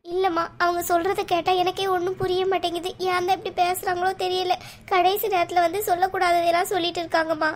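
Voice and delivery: high-pitched weepy wail of a mother; tearful. Acted. Mother telling daughters she can't understand the relatives, tearful. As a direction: plead in bewildered, acted weeping. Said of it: "ஏம்மா அழுறீங்க".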